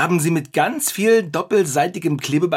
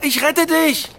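heroic cry; 12 semitones higher, 4 dB louder than usual. Acted. Rushing to rescue friend.

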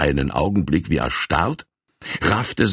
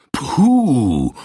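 a sharp, raised huff of offence; indignant puff; 7 semitones higher, 4 dB louder than usual. Acted. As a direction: scoff as if insulted. Colonel's indignant interjection.

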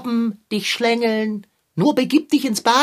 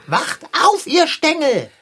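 shouting; 6 semitones higher, 4 dB louder than usual. Acted. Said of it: "wenn du meine Beine anschreist".

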